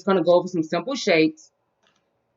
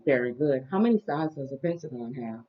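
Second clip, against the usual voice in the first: put-on reading to a toddler; 5 semitones lower, 8 dB quieter, slower than usual. Teaching toddler shapes.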